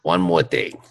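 lightly voiced detective catchphrase; a hint of Columbo, ending low. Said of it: "Missus Columbo".

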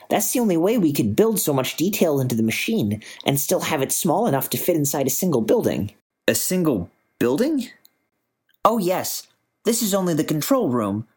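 a keen physicist proudly explaining; eager scientist voice. Acted.

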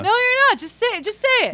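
high, exasperated mock interrogation; pressing friend. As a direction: press him harder, pitch climbing. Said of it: "why don't I be Dee".

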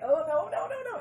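squealing, hammed-up cringe; mock cringing protest. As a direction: recoil in mock horror.

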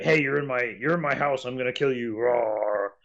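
cardboard evil dragon. Retold or imitated. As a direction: ham up a cardboard villain dragon. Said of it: "a cardboard evil red dragon".

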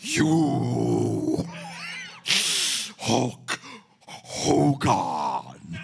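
Ultimate Warrior shouting promo; wrestling promo. Retold or imitated. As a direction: growl out a wild wrestler promo. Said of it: "my best recreation of this promo".

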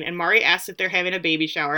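normal talking voice

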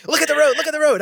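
imitating his wife's repeated nagging. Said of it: "My wife's constantly".

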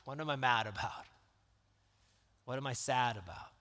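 normal talking voice

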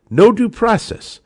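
No due process flung out high and loud, outraged; indignant outburst. Real, not acted. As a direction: spit it out like a protest. Host repeats phrase in outrage.

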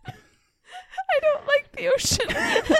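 slow, high, laughing through the words; laughing while speaking. Real, not acted. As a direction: giggle out a protest. Laughing reply to mob threat.

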